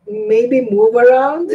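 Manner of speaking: ordinary talk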